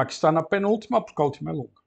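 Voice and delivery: normal talking voice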